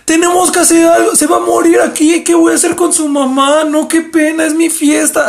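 put-on high, loud panicky drunk girl. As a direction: squeal it like a panicking drunk partygoer. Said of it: "empieza a decir la morra toda peda".

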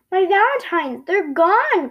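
high, loud cry of alarm; dismayed cry. A narrator's reading. Franklin finds his valentines missing, crying out in dismay. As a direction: cry out at a sudden loss. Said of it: "cried Franklin".

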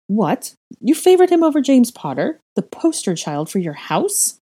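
incredulous scoff, jumping high and loud. A narrator's reading. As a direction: scoff in outraged disbelief. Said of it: "Slytherin scoffed".

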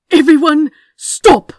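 sudden high, loud shout to halt everyone; commanding shout. A narrator's reading. Man with a gun halts a brawling room, commanding shout. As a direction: shout the whole room to a standstill. Gun raised, halting the fight.

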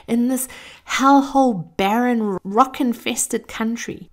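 high, loud, cursing fury; furious swearing rant. Retold or imitated. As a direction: rage at the ugly ghost town. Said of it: "swearing and complaining with great elan".

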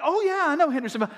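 lightly imitated eager voice; mock knowing listener. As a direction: play the know-it-all. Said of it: "they're like".

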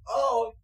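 sudden loud high yelp of laughter; a laugh escapes loudly; laugh bursting out. Real, not acted. Trying not to laugh game.

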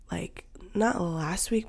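ordinary talk

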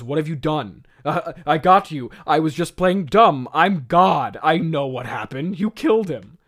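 loud, gotcha accusation; God calling him out. Retold or imitated. God revealing he knew all along, loud gotcha. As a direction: drop the act and nail him loudly. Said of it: "And God goes".

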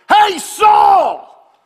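high mocking giant bellow, laughing; dopey cartoon bellow. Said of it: "the giant says to him".

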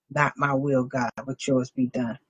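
hushed prayer. Acted. Quiet surrendered prayer.

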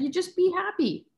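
put-on high, childlike voice; small child saying it simply; little boy voice. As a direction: speak as her small son. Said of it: "He's like".

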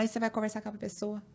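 usual speaking voice

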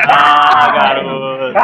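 loud, stretched exclamation; excited shout. Acted. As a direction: belt it out with glee. Exclaiming catchphrase loudly. (exclamação empolgada)

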